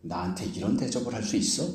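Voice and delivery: imitated grumble, low and very soft; softly voiced resentful question; indignant complaint. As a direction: mutter a mock grievance under your breath. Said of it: "쉽게 이야기하면 이런 식인 거죠".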